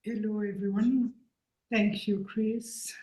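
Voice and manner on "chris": ordinary talk, nothing marked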